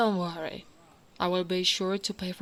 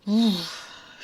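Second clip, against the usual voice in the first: loud, long exhale; relieved sigh; 6 dB louder than usual. Acted. Orc woman reaching her private garden, relieved sigh. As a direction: let out a big sigh.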